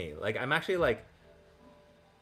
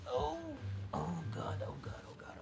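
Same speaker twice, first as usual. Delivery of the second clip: breathless whispered oh God; panicked whisper; 4 dB quieter than usual. Real, not acted. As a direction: whisper it in real alarm. Panicked aside while handling dogs.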